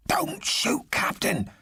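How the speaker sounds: urgent unvoiced whisper. A narrator's reading. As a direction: hiss it up to the window. Intruder at night window.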